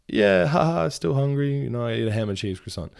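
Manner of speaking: high, forced laughing answer; fake laugh reply. Answering the smug cashier with a forced high laugh. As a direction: play along with a put-on chuckle. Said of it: "And I was like".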